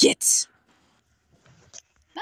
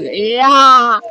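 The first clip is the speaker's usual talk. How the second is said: triumphant yell; 8 dB louder than usual. Real, not acted. Triumphant shout.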